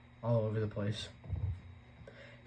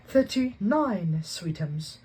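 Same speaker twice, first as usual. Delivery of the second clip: Aunt Petunia cooing; 8 semitones higher, 7 dB louder, much slower than usual. A narrator's reading. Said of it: "said Aunt Petunia".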